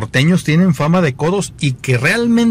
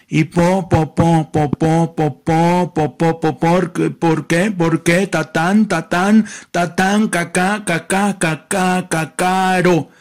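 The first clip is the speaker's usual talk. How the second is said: heavy stuttering, pitched up, syllables repeated; mock a nasal man who stutters on every word; nasal stutterer; 3 semitones higher, slower than usual. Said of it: "dice el gangoso y tartamudo". (imitación de tartamudo gangoso, voz aguda)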